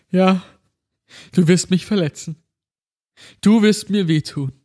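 high, tearful and frightened; holding back sobs. Acted. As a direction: sob the words out. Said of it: "Er schluchzte".

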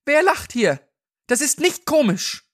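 high, indignant cry; angry shout. Acted. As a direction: shout it at an unseen joker. Angry voices in panicked crowd.

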